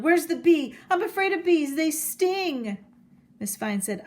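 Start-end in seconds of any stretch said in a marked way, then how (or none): 0.0-2.8 higher, loud panicked cry from a moose; frightened yell. Acted. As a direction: yell it out in fear of bees. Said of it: "and he yelled".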